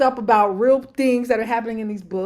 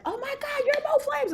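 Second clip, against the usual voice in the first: high-pitched, hushed fangirl awe; starstruck fan; 3 dB quieter than usual. Retold or imitated. Fan recognising her favorite author, mock starstruck. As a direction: imitate an amazed fan in a high little voice.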